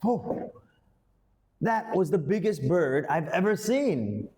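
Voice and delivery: amazed disbelief. Shown as a demonstration. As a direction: pitch jumps high on Oh, then wide-eyed wonder. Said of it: "you say".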